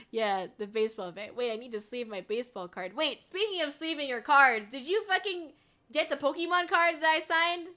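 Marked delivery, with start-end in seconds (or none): 2.9-7.7 excited. Real, not acted. Excited rant about signed cards.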